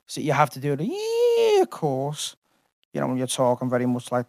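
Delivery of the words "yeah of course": high, squeezed Scouse footballer impression; Gerrard. Showing how to do the footballer's voice, Scouse impression. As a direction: start very high on a stretched first word, then drop. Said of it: "Gerrard for me is like Tony Bellew".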